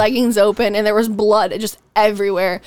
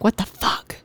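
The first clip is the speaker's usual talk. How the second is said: whispered what the fuck; whispered shock; 2 semitones lower, 6 dB quieter than usual. Retold or imitated. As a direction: breathe it out in shock. Friends stunned by third bottle.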